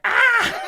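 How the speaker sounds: very high, very loud scream. Acted. Girl fleeing a moose, scream. Moose charges her hair.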